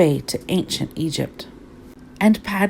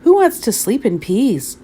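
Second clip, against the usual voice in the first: higher, puzzled exclamation; incredulous question; 5 semitones higher, 4 dB louder than usual. A narrator's reading. Kitten questions the doggies, incredulous exclamation. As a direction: ask it high in disbelief.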